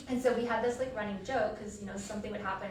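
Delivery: ordinary talk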